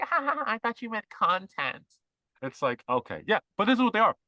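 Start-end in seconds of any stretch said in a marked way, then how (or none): 0.0-1.8 high-pitched mock giggly girl; ditzy girl. Girl who misheard continent as content, high mock ditzy voice. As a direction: imitate an airhead realising her mistake, pitched way up.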